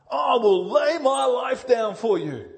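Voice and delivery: high, loud, boastful hero vow; superhero Peter. Acted. Peter boasting to Jesus like a would-be superhero. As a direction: puff up like a superhero. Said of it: "He wants to go and be superhero".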